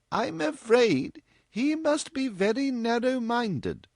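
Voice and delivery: high, slow, gentle mother. A narrator's reading. Mother gently remarks on the young man, gentle. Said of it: "said Missus West gently".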